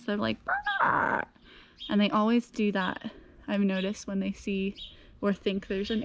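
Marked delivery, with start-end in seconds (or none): 0.4-1.2 very high rolled brrr like a chicken alarm; imitated chicken trill. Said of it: "like, dinosaur noise".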